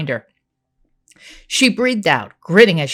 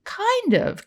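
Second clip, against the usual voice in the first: high, smirking Kind of. Acted. Karen admits it to her friend with a smirk. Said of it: "Karen smirked".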